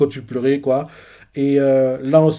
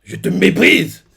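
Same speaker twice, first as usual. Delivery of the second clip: contempt; 5 semitones higher, 6 dB louder, slower than usual. Retold or imitated. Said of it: "on voit Dragon qui fait".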